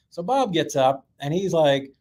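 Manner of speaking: plain reading voice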